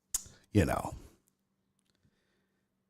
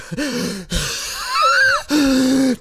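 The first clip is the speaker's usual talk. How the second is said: panicked shouted sigh; 11 semitones higher than usual. Retold or imitated. Patient mocking her own frantic breathing for the doctor. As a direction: heave the breath out in a high panic.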